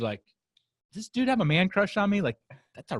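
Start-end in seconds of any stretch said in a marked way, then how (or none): 0.9-2.2 bewildered. Retold or imitated. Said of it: "like, bewildered".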